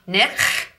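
throaty German ch in Nich; guttural nich. Shown as a demonstration. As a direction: scrape the ch of Nich in the back of the throat. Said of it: "we'll use the words nich and nach".

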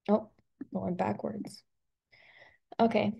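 ordinary talk, nothing marked

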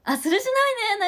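high, loud eager recognition; light up as it clicks; excited agreement. Real, not acted. Repeating the dialect word.

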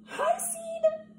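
very high squeaky little greeting; tiny seed voice. Acted.

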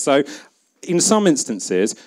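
normal talking voice

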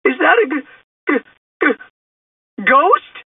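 high, stuttering cartoon fright; high-pitched, scared question with broken starts; frightened stammer. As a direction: stammer in terror before the last word. Shaggy sees a ghost, stammers.

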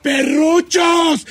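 high, loud, slow shouted headline ending in a yell; mock outraged shout. Host shouting the concert brawl news at listeners, mock outrage. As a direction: rant the news like a furious announcer.

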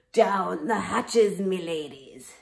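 loud, slow pirate bellow; pirate yell. Acted. Captain yelling at his crew, loud pirate bellow. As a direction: roar it across the table. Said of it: "pounded his fist on the table and yelled".

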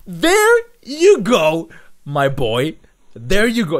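drawn-out cheer. Real, not acted. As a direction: savour it, drag it out proudly. Celebrating the contestant's choice.

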